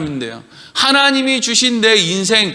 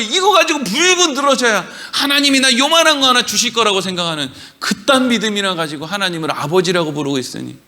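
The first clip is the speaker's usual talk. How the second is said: ordinary talk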